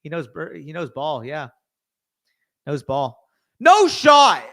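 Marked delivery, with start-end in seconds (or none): 3.6-4.4 loud, high yell of disbelief; real outraged shout, high and loud; disbelieving shout. Real, not acted. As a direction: shout it like the pick just robbed you. Stunned by the draft pick.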